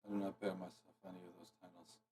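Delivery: very quiet and fast, far from mic; barely audible, rushed words; quiet voice loud hiss. Real, not acted. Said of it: "for you are so quiet".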